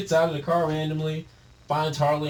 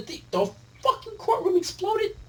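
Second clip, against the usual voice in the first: much higher incredulous squeak; disbelief. Real, not acted.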